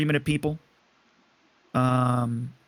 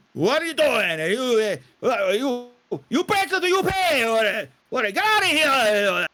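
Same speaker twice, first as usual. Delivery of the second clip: manager yelling; 9 semitones higher, slower than usual. Acted. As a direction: bellow at the unruly drunks. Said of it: "he starts yelling and screaming".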